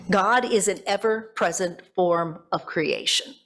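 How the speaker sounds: usual speaking voice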